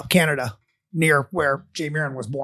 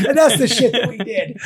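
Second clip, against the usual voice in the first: laughing; 5 semitones higher than usual. Real, not acted.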